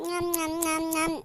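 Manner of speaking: low, soft nom-nom chewing; munching noises. Acted. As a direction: make little munching sounds while eating. Child eating the tasty dosa. (மென்று சாப்பிடும் சத்தம்)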